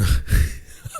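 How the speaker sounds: airy burst of laughter; a teller laughing at the punchline; loud laugh. Real, not acted. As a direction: crack up mid-story.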